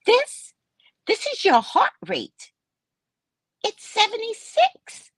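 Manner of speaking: high, slow mock doctor; doctor reading results. Doctor slowly reading heart rate off the oximeter. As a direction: draw out the numbers like a verdict.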